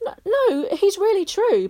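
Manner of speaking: put-on a boy defending his friend; protesting. A narrator's reading. Barney protesting Stig is real, high insistent voice. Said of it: "Barney protested".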